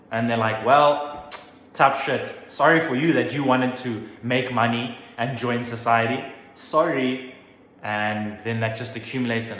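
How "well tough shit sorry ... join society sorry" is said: louder sneering brush-off; mock them with fake sympathy; mocking dismissive. Institutions answering indebted graduates with loud sneering fake sympathy. They're like, tough shit.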